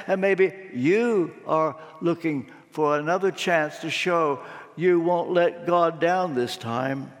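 usual speaking voice

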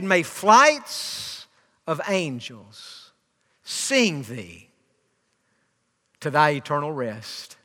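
low, hushed, slow recitation; solemn farewell. Retold or imitated. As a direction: recite a tender blessing with long pauses. Solemn farewell to mother, quoted.

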